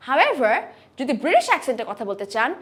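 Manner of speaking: usual speaking voice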